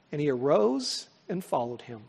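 normal talking voice

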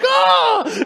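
very high loud oh of amazement; mock astonished oh. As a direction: shriek a surprised oh. Doctor's amazed exclamation.

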